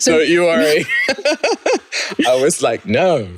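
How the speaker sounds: high laughing protest. Real, not acted. To the interviewer, laughing about saying no. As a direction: laugh through the memory of refusing.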